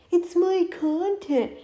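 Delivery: drawn-out, high-pitched mockery; mocking whiny reply. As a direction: mimic a petulant excuse. Mocks the creator's reply.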